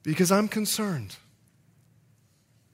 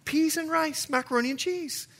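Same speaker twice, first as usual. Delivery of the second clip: higher, lilting Bahamian accent; 8 semitones higher than usual. Real, not acted. Preacher tells church of his island roots, Bahamian accent. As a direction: let the Bahamian accent come out. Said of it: "you can hear a little bit of my accent coming out".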